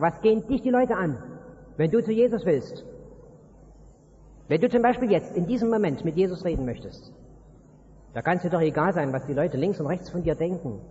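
normal talking voice

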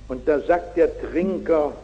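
usual speaking voice

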